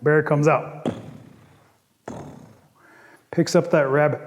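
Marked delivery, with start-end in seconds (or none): none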